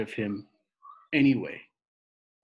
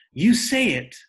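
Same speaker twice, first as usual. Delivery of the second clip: screaming demand; 10 semitones higher, 8 dB louder than usual. Acted. Rob demanding his father say his mother's name. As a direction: scream each word as a demand.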